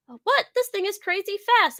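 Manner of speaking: high startled yelp, half acted; surprised shout. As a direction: gasp in mock amazement. Quoting a startled character.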